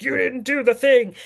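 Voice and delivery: high, loud, drawn-out monster scolding; nasty monster voice. Retold or imitated. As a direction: put on a mean monster, slow and loud. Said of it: "in that, like, nasty monster voice".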